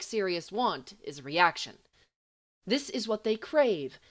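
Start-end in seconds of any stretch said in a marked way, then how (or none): none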